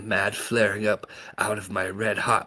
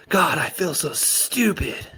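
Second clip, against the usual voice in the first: despairing; 11 semitones higher, 4 dB louder than usual. A narrator's reading. Betrayed boy despairing.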